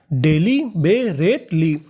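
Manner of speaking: Daily बेरेटली stretched slowly, de-li be-ret-li spaced apart; slow syllable by syllable. Shown as a demonstration. Teacher sounding out daily बेरेटली slowly for class. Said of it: "तो पूरी meaning".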